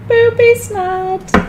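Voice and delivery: slow, high, giggled through; laughing. Real, not acted. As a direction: squeeze the words out while laughing. Laughing at her own joke.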